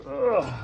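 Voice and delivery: a short high strained grunt; effort grunt. Real, not acted. Hoisting the tape deck upright, high strained grunt.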